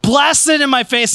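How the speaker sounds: usual speaking voice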